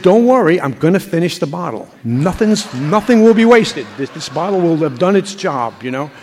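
loud, laughing bravado to the shocked host; cocky reassurance. Retold or imitated. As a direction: brush off their horror with a grin, slowed on the big promise. Retelling his boast to host.

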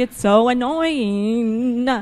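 slow, drawn-out mocking complaint; mimicking a drawn-out whiny gripe; mock whine. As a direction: stretch it out like a sulky whiner. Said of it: "I've heard people who say that".

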